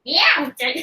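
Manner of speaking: high cartoon cat voice; squeaky anime cat; Meowth voice. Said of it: "I was trying to do a Meowth voice".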